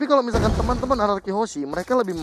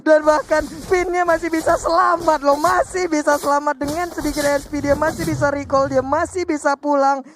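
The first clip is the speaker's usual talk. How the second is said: excited commentary; 7 semitones higher, 4 dB louder than usual. Real, not acted. Co-caster hypes survival.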